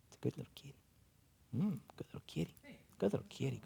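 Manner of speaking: quiet loving coo; cooing to a cat. Real, not acted. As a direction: murmur praise to the kitty.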